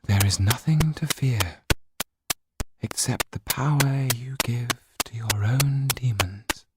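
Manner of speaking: slow, lightly eerie voice; spirit voice. Acted. Spirit reassuring Yan on the stage, slow. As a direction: speak it slowly like a spirit. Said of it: "what the spirit said".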